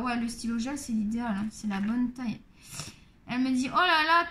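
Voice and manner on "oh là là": imitating a fussing practitioner, louder and higher; retold loud cry of concern; mock dismay. Chiropractor exclaiming over a patient's sore side, mock dismay.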